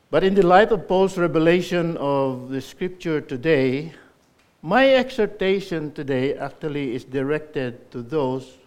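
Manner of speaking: loud, urgent exhortation; full-force preaching. Acted. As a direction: call out to the congregation.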